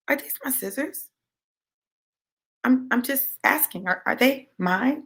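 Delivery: soft, deceptively innocent question; hushed creepy calm, acted out; calm crazy. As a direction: ask quietly with mock-innocent menace. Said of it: "calm, crazy voice".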